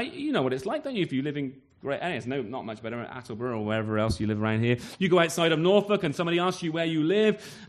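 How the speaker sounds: usual speaking voice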